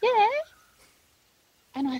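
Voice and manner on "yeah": tiny high-pitched far-off answer; squeaky little reply. Retold or imitated. Missing girl answering from afar, squeaky little call. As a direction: imitate a small child's faint squeak. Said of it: "it was such a squeaky little sound".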